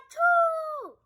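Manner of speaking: mock sneeze. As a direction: let a sneeze burst out. Sneezing in the story.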